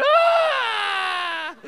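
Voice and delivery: loud, high imitation crying; exaggerated crying yell; mock child's wail. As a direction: let out a hammed-up kid's bawl. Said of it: "he's already crying".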